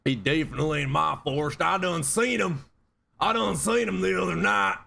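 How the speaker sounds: hammed-up yokel swearing he saw Bigfoot; backwoods Bigfoot witness. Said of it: "I could do that all day".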